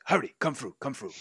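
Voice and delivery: lightly voiced, hurrying lizard guide; lizardfolk character. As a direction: usher them along as a creature. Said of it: "And he goes".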